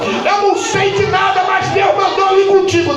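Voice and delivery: high, driving revival preacher delivery; full-force preaching. Real, not acted. Preacher testifying to the church about the pastor, full-force preaching.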